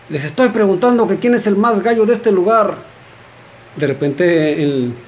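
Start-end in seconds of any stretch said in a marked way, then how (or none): 0.1-2.8 raised, higher-pitched demand; insistent shouting. Acted. Gunslinger demands an answer from cowering drinkers, insistent mock shout. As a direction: press the challenge on a silent room, half-yelled. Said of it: "vuelve a gritar".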